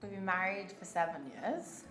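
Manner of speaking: ordinary talk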